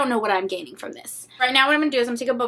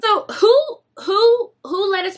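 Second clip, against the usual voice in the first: exasperated cry; 8 semitones higher, slower than usual. Real, not acted. Mock outrage at the long pick.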